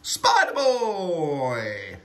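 long, stretched-out holler; drawn-out call. Real, not acted. Dad calling out a nickname, drawn-out call. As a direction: draw the name right out as you call.

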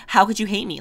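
hammed-up disbelief at a hater; How could you hate me, thrown out in mock offence; mock indignant. Mock outrage at a video disliker.

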